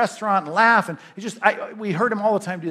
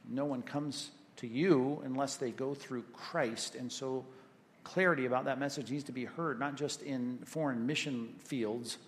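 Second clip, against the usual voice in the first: soft, earnest prayer voice, lower and quieter; closing prayer; 4 semitones lower, 11 dB quieter than usual. Acted. Pastor prays for his church in a hushed, earnest voice. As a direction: pray gently over the congregation.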